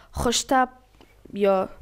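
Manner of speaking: normal talking voice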